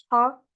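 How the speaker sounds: first "ha" rasped in the throat, second light; contrasted ha sounds. Shown as a demonstration. Teacher contrasting two letters, rasped "ha" then light "ha". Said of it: "Il y a une différence entre".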